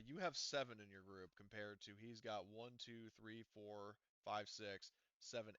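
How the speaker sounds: usual speaking voice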